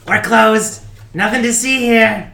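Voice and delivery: high, loud yell to send him away; shouting. Acted. Velaya shouting to keep him out.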